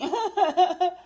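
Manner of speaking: quick chuckle; short laugh. Real, not acted. Host laughing after saying nobody can get her, short chuckle.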